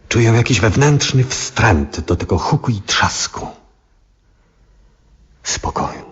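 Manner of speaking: choked, whispered plea for peace; tearful whisper. Acted. Old astronomer alone at his window, whispering and near tears. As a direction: breathe the words out almost crying. Overwhelmed old man pleading inwardly.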